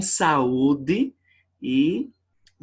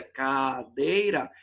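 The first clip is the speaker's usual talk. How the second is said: cadeira spaced out as ca-dei-ra; teacher counting three beats in cadeira; slow syllable split; 4 semitones lower, much slower than usual. Shown as a demonstration. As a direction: spell cadeira out syllable by syllable, slowly. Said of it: "três sílabas".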